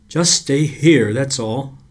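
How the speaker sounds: slow, loud and firm; firm insistence. A narrator's reading. Boy firmly orders Margaret to stay put, slow and loud. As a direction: hold her back with a firm order.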